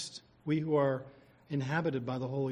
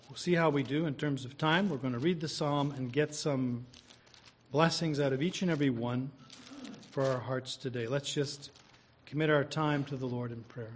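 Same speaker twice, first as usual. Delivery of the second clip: ordinary talk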